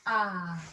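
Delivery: a wide-open "Ah", mouth open; saying ahh. Shown as a demonstration. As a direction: open "Ah" like at the doctor's. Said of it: "pretend that you're at the doctor's".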